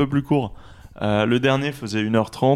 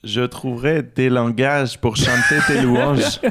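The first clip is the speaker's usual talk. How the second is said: slow, hammed-up Québécois accent; botched Canadian accent. Shown as a demonstration. Quizmaster repeats the lyric on request, failed Canadian accent. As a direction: badly fake a French-Canadian accent, drawn out. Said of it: "le dire avec l'accent canadien raté".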